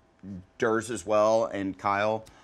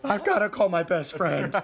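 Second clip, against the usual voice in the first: mock sobbing; 9 semitones higher than usual. Joking about everyone sobbing.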